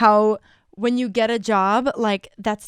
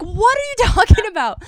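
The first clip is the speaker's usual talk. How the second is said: high, laughing, incredulous question; laughing disbelief; 11 semitones higher, 4 dB louder than usual. Retold or imitated. Retelling her baffled reply to vague feedback, laughing in disbelief. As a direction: act baffled and crack up mid-line.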